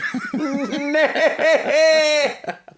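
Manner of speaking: high, loud, laughing neigh; attempted horse whinny. Shown as a demonstration. Friend failing at horse noises, laughing whinny. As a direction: have a hopeless go at neighing. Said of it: "I can't do horse noises".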